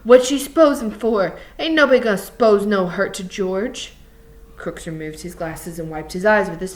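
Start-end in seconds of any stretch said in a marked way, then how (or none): 0.0-3.9 loud, menacing challenge; angry Lenny. A narrator's reading. Lenny leaning over Crooks about George, angry. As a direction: confront him forcefully, volume up. Lenny's dialogue in story narration.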